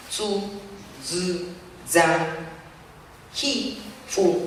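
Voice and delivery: Japanese tsu, zi, za, hi, fu said slowly, one by one; Japanese syllables. Shown as a demonstration. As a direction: spell out each Japanese sound apart. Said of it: "en la pronunciación del sonido". (sílabas japonesas pronunciadas una a una)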